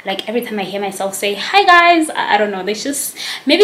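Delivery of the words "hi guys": high, louder vlogger greeting; high-pitched greeting. Retold or imitated. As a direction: mimic your own high-pitched hello. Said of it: "like, high-pitched".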